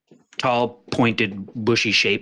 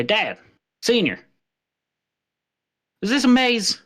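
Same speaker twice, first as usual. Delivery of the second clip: calling to dad; 12 semitones higher than usual. Acted. Shrub calls to father.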